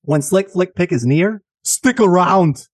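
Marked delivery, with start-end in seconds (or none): 1.6-2.7 mock biblical prophet. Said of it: "falsetto prophet's voice you hear".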